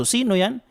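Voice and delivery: "Sino yan?" pitched high with a sharp rise on "yan"; rising question tune. Shown as a demonstration. Teacher shows the right Tagalog question tune. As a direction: ask it with a clear lifting question. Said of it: "kailangan patanong din po yung tono natin".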